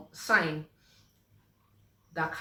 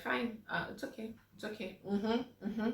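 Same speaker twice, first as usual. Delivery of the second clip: soft, fast, half-swallowed one-word replies; imitating someone too drained to answer; mumbling mm-hmm barely above a breath; monosyllabic mumble; 4 semitones higher, 7 dB quieter than usual. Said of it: "your responses in conversations start to become monosyllabic".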